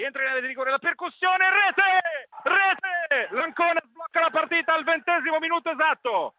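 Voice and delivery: high, loud goal scream; breathless italian goal commentary. Real, not acted. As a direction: erupt as the ball goes in. Goal scored, commentator erupts.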